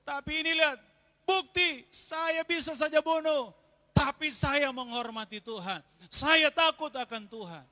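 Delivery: loud, high, fervent preaching; loud pulpit preaching; preaching at full force. Acted. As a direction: hammer it home to the congregation.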